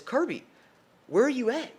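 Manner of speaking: high-pitched imitation of a calling mom; mom demanding whereabouts. Mom phones her son to find out where he is, high-pitched questioning.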